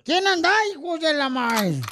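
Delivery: high, hammed-up threatening rant; acted soldier's threats. Soldier warning unseen intruder, hammed-up threats.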